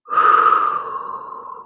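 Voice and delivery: long, airy exhale; long sigh. Real, not acted. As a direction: let out a slow breath. Exhales before starting the next reading.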